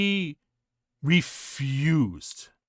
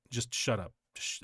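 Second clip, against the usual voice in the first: muttering to self; 3 semitones lower, 8 dB quieter than usual. Retold or imitated. Inner voice telling himself hush.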